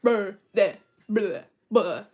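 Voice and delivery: loud, low bleating "bah"; real goat bleat. Retold or imitated. Showing viewers how goats really sound, bleating. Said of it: "they really sound like this".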